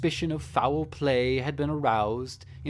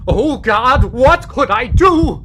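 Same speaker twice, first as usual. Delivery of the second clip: building from loud and raised to a high screaming frenzy; a madman's confession swelling from raised voice into unhinged screaming; frantic rising shout; 8 semitones higher, 16 dB louder than usual. Acted. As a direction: let the guilt crack you open, louder and higher to the end. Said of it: "argued about trifles in a high key".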